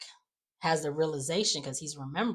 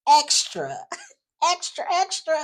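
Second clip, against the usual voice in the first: high, loud, hammed-up exclamations; mock dramatic; 12 semitones higher, 8 dB louder than usual. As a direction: sing out each word, over the top. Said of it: "Just being real dramatic".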